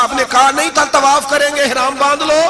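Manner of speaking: high-pitched pleading question; agitated challenge. Acted. Umar protesting.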